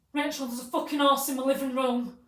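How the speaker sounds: high, loud mimicked phone complaint; acted irate yell. Retold irate phone call.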